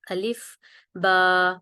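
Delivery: الف، با drawn out slowly, each letter held; letter demo. Shown as a demonstration. Teacher showing learners الف and با, very slow. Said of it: "اور با ادا ہو".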